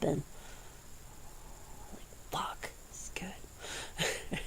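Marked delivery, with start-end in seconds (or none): 1.9-4.4 unvoiced whisper, very soft; under the breath, almost silent; hushed savouring. Real, not acted. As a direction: whisper it, relishing the food. Savouring the crispy food.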